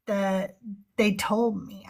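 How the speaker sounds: ordinary delivery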